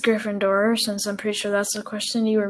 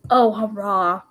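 imitating a half-hearted hurrah; mock the limp little hurrah; mimicked underwhelmed cheer; slower than usual. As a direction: echo someone's unenthusiastic cheer. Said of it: "The way you said that".

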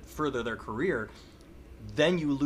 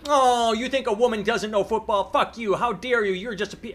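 much higher and louder, hammed-up indignant tirade; mock furious outrage; 10 semitones higher, 7 dB louder than usual. Host parodying critics attacking Newton, loud mock fury. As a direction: explode like an offended online mob.

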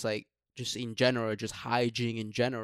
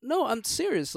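pitched way up, no I'm serious; insistent; 12 semitones higher than usual. Real, not acted. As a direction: insist it, voice jumping high. Sudden high insistence.

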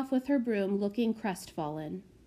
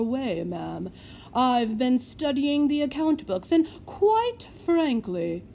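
haughty lord; 3 semitones higher, 6 dB louder than usual. Acted. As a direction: look down your monocle. Lord gets to business.

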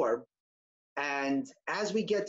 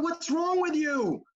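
incredulous shout, much higher and louder, slow; exasperated; 9 semitones higher, 3 dB louder, slower than usual. Retold or imitated. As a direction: blurt it out in disbelief. Exasperated thought at unhelpful waiter.

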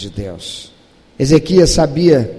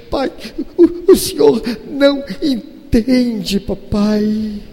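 lightly voiced child, higher and slow; father mimics his sobbing eight-year-old, a little higher; imitating tearful daughter; 6 semitones higher, slower than usual. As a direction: mimic a little girl through tears.

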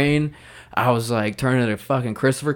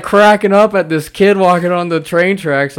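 laughing; 9 semitones higher, 10 dB louder than usual. Retold or imitated. Laughing recounting.

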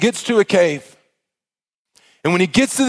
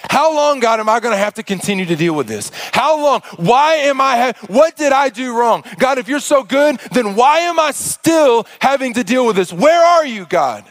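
frustrated yell; 7 semitones higher than usual. Acted. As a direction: shout your frustration up at God. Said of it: "he screams out and he yells in frustration".

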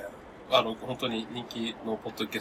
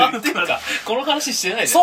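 much higher and louder, sudden; surprised; 11 semitones higher, 11 dB louder than usual. Real, not acted. Host suddenly realising they skipped the topic. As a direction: blurt it out, shocked.

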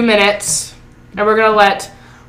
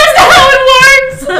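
very high, laughing, almost crying; laughing exasperation; 5 dB louder than usual. Retold or imitated. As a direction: wail it out through laughter. Retold exasperation at child.

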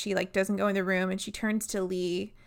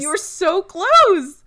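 very loud, high-pitched, drawn-out wail; exasperated outcry; 11 semitones higher, 12 dB louder, slower than usual. Real, not acted. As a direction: cry it out slowly in comic frustration. Exasperated at the botched comeback.

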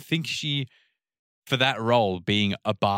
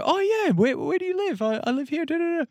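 very high-pitched mock phone chatter; imitated flirty small talk. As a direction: float it up high, chatting someone up. Retold flirty phone chat.

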